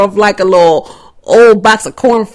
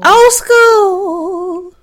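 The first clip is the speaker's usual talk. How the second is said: high sing-song call; 9 semitones higher than usual. Real, not acted. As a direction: toss out the catchphrase way up high. Podcast segment call-out.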